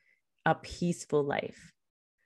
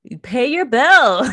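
louder, higher, slow sassy retort; 6 semitones higher, 10 dB louder, slower than usual. Retold or imitated. Answering the lady about her power bill, loud and sassy. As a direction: talk sense into her, girl. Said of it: "I'm like".

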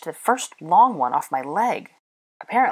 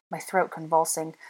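ordinary delivery

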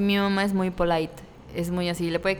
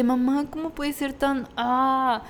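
high, exasperated whine to mom; exasperated disbelief; 6 semitones higher, slower than usual. Retold or imitated. Exasperated at mom's quick shopping, high whine. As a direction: stretch out the disbelief. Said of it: "Y yo así de".

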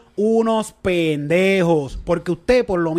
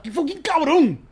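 outraged yell; 10 semitones higher, slower than usual. Real, not acted. Telling friends what the boss said, real outraged shout. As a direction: shout it out in disbelief.

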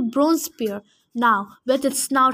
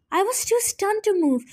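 ordinary delivery, nothing marked

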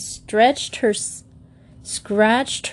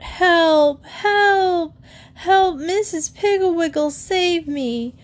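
shouting for help; 9 semitones higher, slower than usual. A narrator's reading. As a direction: scream for help upside down. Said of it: "Then he began to shout".

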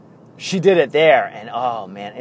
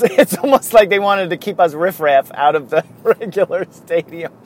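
laughing through the words, higher pitch; amused; 6 semitones higher, 6 dB louder than usual. Real, not acted. Fan recalling being kept out of the regular stadium, laughing. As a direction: tell it with laughs breaking in.

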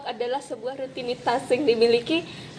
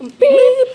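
mock censor beep; 12 semitones higher, 8 dB louder than usual.

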